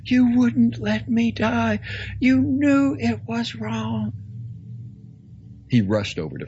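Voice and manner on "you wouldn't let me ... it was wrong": high, loud, slow amazement; awed joy. Acted. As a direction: marvel aloud at the miracle. Awed priest after being saved.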